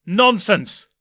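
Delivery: scornful loud snap; spat back. Acted. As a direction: spit the word out. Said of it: "the doctor spat back".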